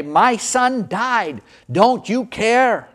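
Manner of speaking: high, very slow, aching cry; pained outburst at an uncaring crowd; anguished cry. Retold or imitated. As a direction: let the grief break out, drawing each word long. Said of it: "I wanted to jump up and say".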